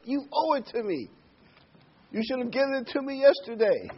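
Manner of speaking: a hammed-up entitled voice; entitled complainer. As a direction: sneer it like a spoiled ingrate. Preacher voicing entitled complainers.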